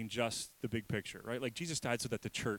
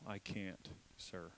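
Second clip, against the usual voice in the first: softer, fading to nothing; faint reply; 3 semitones lower, 12 dB quieter, slower than usual. Acted. Sick man answering Jesus in a fading voice. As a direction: let it die away.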